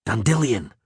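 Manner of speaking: plain reading voice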